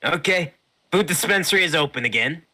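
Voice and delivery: high, loud merchant voice; shopkeeper voice. Acted. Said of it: "He says".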